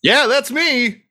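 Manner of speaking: high, slow, drawn-out boast; showy self-introduction. Acted. Gnome owns up to fame before an admirer, showy. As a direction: stretch it out high like a star. Gnome shows off after recognition.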